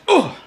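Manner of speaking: sudden high-pitched "oh" of impact; short, very high mock yelp; acted hit reaction. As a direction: act out taking a blow to the chin. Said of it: "and she just".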